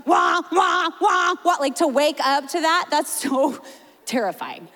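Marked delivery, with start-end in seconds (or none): 0.0-1.6 loud high alarm wail; alarm imitation. Pastor imitating a terrifying childhood alarm, loud. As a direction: blare it like an old alarm clock. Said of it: "how terrifying they are".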